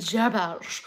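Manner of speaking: garbage said through a laugh; amused echo. Real, not acted. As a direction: laugh as you repeat it. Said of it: "laughs garbage".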